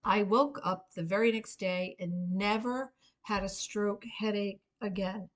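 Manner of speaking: ordinary delivery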